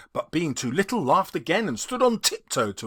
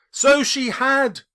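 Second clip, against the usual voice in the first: high, loud, slow agreement; eager cry; 8 semitones higher, 6 dB louder than usual. Acted. Scrooge crying out agreement to the ghost. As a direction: burst out in heartfelt agreement. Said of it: "cried Scrooge".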